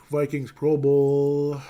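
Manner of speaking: slow, loud Vikings Pro Bowl; drawn-out announcer read. Real, not acted. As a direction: stretch the headline out like an announcer. Reading headline dramatically.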